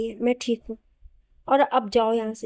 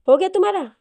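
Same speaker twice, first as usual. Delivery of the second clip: sharp and higher, cutting him off; angry snap; 8 semitones higher, 6 dB louder than usual. Acted. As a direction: bite the words off in anger. Wife cuts husband off.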